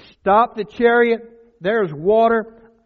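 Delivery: loud, high, drawn-out command; urgent calling out. Acted. Eunuch halting the chariot at the water, loud call. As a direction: call it out slowly at full voice.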